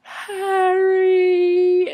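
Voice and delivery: high, loud, drawn-out squeal of a name; long high-pitched fan cry; excited fan's call. Retold or imitated. Calling out to the star she met.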